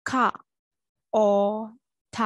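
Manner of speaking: "caught" split into ခ, အော်, ထ with long gaps; separate, held sounds ခ, အော်, ထ for "caught"; sound-by-sound breakdown. Shown as a demonstration. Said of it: "အတန်အတွက်ကိုကြည့်မယ်".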